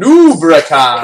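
booming, drawn-out announcer call. Shown as a demonstration. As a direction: boom the name like a game announcer. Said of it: "say his name like, like the announcer says his name".

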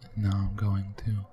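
low, close-mic whispery voice with long pauses; mock ASMR. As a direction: tease out each phrase like an ASMR performer. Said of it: "Have you ever delved into A S M R?".

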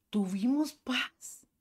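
a slow, hushed whisper of peace after loss. Real, not acted. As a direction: breathe it out in a whisper, slowly. Recalling peace after son's death.

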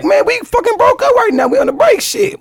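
high-pitched, drawn-out objection; indignant mock pleading, pitched high; retold protest. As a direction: act out protesting to friends, voice jumping up. Retold protest about a breakup.

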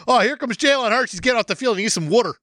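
sportscaster voice. Pretend. Mock sports commentary.

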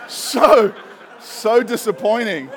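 loud, high, hammed-up dismay; mock disappointed groan. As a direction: friends hamming up their disappointment. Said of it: "And we're like".

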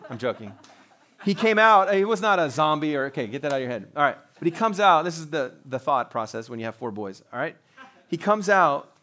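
usual speaking voice

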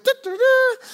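high sung mock trumpet fanfare. Pastor mocks showy givers with a sung trumpet fanfare. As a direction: toot it like a trumpet blast. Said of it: "sound no trumpet".

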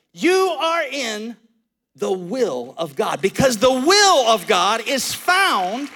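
slow, deliberate preaching with long pauses; emphatic preaching. Acted. Pastor to congregation, slow emphatic preaching. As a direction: lean on every word like a sermon climax.

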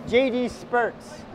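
J D Spurts said slowly with spurts drawn out; spelled-out joke rebrand said slowly; slow punning pronunciation. Shown as a demonstration. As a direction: stretch out Spurts as a slow pun. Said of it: "wordplay on J D Sports".

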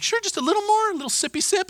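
put-on very high, baby-talk wheedle; mock coaxing. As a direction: coax playfully, as if tempting a toddler. Coaxing kid to keep drinking.

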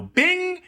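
high bright bing; ding like a warning bell; mock alert chime. Imitated alert sound.

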